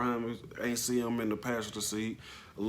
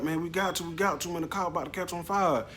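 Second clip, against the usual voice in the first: urgent insistence; 8 semitones higher than usual. Retold or imitated. Bystanders urging to move him.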